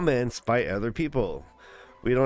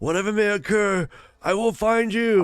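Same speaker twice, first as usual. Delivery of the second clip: dramatic movie hero; 7 semitones higher than usual. Retold or imitated. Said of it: "they had the last Mohican moment".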